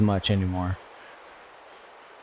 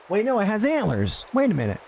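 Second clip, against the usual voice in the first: surprised; 9 semitones higher, faster than usual. Real, not acted. Sudden realization.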